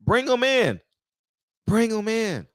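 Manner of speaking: high, eager repeated demand; fired-up retort. Retold or imitated. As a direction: cheer them on to send troops in. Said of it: "I was like".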